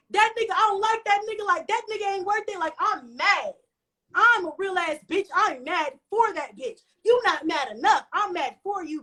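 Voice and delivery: high, heated rant in a retold argument; mad, passionate. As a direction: go off in furious loyalty to a friend. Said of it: "I was so mad and so passionate".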